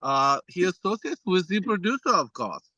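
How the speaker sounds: imitating a thick German accent, lightly done. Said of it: "in his thick German accent".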